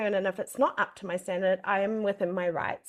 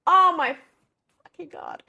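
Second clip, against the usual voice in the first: disbelieving swear; 8 semitones higher, 8 dB louder than usual. Retold or imitated. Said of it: "I look in the bag and I'm like".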